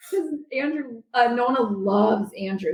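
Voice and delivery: usual speaking voice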